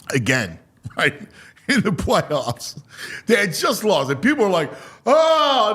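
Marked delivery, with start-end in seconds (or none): 5.1-5.7 drawn-out wail of dismay, higher; mock devastated. Fans reacting to the Sixers losing, mock devastated. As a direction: groan it out high like crushed fans. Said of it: "they were all devastated".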